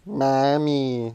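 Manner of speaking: mocking whine. Brother mocks sister calling for mom, exaggerated whine. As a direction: parody a sister's call, stretched out. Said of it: "He'll, like, mock you".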